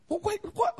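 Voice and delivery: high startled double take; mock surprise. Jacob realising Laban's trick, high mock surprise. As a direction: play a caught-off-guard "wait, what?".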